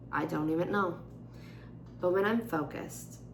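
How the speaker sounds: normal talking voice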